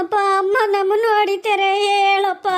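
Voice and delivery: drawn-out tearful appeal; wailing complaint. Acted. Child tells father that mother hits them, wailing. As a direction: wail for a parent's help.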